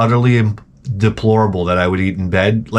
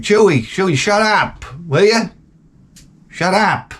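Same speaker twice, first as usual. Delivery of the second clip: higher-pitched exasperated call; annoyed scolding; 8 semitones higher than usual. Acted. Owner woken by his barking dog, irritated scolding. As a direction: tell off a noisy dog, voice raised in pitch.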